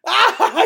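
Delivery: high, loud manic cackle, imitated; mock over-the-top laughing shriek; imitated crazy laugh. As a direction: cackle like a guy who finds the fight hilarious. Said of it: "He does that crazy laugh".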